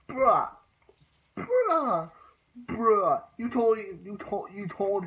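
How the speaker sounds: stretched, disbelieving cry of bruh; exasperated bruh. Real, not acted. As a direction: blow up in disbelief at the principal. Exasperated rant at the principal.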